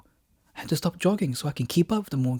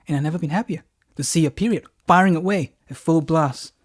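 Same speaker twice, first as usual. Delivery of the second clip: ordinary delivery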